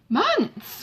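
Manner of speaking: Months! cried out high, with a falling end; shocked outcry at the idea of months; strong surprise. A narrator's reading. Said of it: "cried Marianne with a strong surprise".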